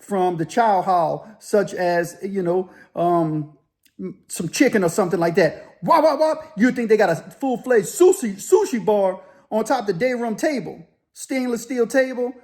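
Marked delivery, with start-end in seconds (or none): 5.8-6.5 short high burst of "wop" chops; imitated chopping noise. Dudes chopping chicken for the spread, imitated chopping noise. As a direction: imitate quick knife chops, pitched up high.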